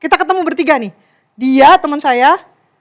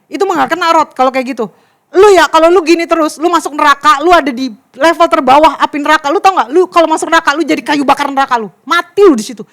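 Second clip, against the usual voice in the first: imitating a louder, harsh telling-off; fierce scolding; 5 dB louder than usual. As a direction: lay into them with hellfire threats, as a retold rant. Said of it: "orang ini yang galak banget".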